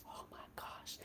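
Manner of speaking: soft whispered awe. Real, not acted. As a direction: breathe it out in wonder.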